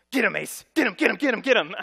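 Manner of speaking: sicking the dog. Retold or imitated. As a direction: egg the dog on, yelling. Dad sics dog on neighbor.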